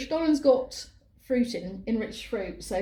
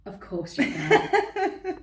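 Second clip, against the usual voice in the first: laughing; 5 semitones higher, 4 dB louder, much slower than usual. Real, not acted. Teasing answer about tasting cake.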